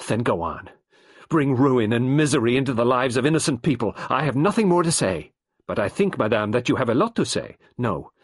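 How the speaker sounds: wife defiant, detective insisting, both loud; defiant anger. A narrator's reading. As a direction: flash back at him. Angry final clash.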